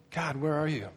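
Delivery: soft, understated plea; acted hushed appeal to God; quiet questioning prayer. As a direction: murmur a doubtful prayer. Voicing Paul's private doubt to God.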